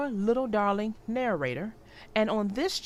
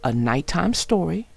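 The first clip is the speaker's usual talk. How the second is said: put-on clear, lively read-aloud for young children; storybook narration; 8 dB louder than usual. A narrator's reading. Narrator reading Franklin's Blanket to young viewers, storybook read-aloud. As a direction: tell the story warmly to little listeners.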